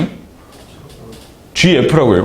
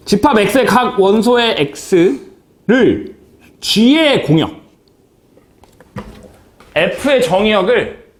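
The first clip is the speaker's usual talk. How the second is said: slow reading; 4 semitones higher, much slower than usual. Shown as a demonstration. Reads definition slowly to class.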